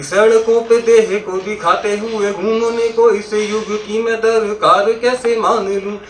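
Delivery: slow, chanted verse, opening loud; lilting stage poem with a forceful start; emphatic poetry recitation. Acted. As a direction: declaim it in drawn-out metre.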